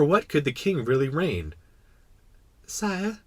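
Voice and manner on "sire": high, tentative small voice; venturing little prince. Acted. Little prince venturing to address the king, tentative. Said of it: "he ventured".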